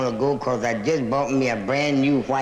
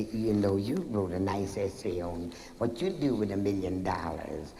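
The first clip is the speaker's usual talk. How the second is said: teacher; 7 semitones lower, 7 dB quieter than usual. Acted. Teacher praising, growing soft.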